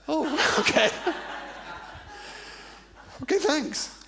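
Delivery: high-pitched, mock deflated pupil; sheepish acceptance. Pupil told by teacher he missed the exam, mock sheepish. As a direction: squeak out a feeble acknowledgement with a laugh.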